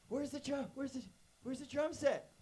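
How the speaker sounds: put-on high-pitched frantic kid voice; breathless high, stumbling over the question; excited child. Kid hunting for the drum set, excited. Child running down on Christmas morning.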